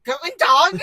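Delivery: loud, jokey German greeting; mock German accent. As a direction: bark out a hammed-up German hello. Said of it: "Do a German".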